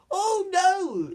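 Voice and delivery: high, loud drawn-out cartoon "oh no"; mock alarm. Villain caught out by the hero, mock alarm.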